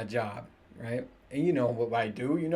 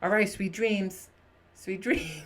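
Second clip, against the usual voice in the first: mock parent goodnight; 7 semitones higher, slower than usual. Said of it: "You're like".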